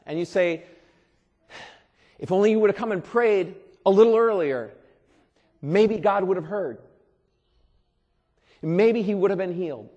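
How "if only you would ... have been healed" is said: upset relative. Retold or imitated. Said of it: "you are a little upset".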